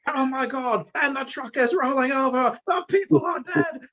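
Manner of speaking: high, shouting British commentator voice, slowing at the end; screaming British announcer. Retold or imitated. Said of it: "I would scream in this, like, British accent".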